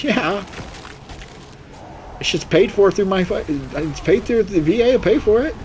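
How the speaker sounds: high, sing-song retold answer; high mock reply. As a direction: pitch it up like a cheeky comeback. Retelling his reply to nurse.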